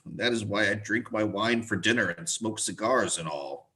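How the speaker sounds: normal talking voice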